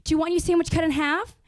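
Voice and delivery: lightly voiced fussing mom offering choices; doting mom. Mother pampering teenage son.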